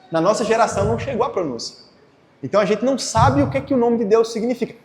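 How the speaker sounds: ordinary talk, nothing marked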